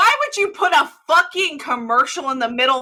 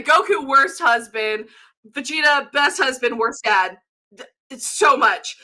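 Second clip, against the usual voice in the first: usual speaking voice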